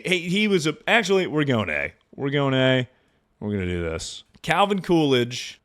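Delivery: normal talking voice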